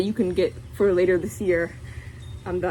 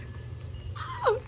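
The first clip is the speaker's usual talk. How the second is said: gasp; 9 dB quieter than usual. Real, not acted. First sees the suit.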